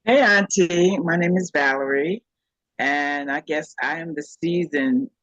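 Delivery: ordinary delivery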